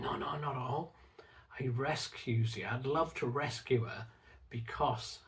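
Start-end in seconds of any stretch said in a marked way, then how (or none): 0.0-0.9 soft, tender reassurance; reassuring. Retold or imitated. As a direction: gently wave away the worry. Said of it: "It's like".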